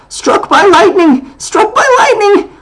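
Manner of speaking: very high, loud, slow terrified wail; panicked shriek. Acted. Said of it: "kept on calling out".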